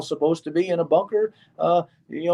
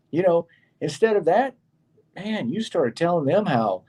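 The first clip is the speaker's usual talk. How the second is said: ordinary talk, nothing marked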